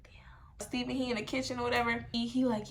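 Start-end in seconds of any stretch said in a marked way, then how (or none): 0.0-0.7 breathy unvoiced whisper of dread; whispered damn. Daughter hears mom call her down, whispers damn. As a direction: mutter it under your breath, caught. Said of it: "quiet dread reaction".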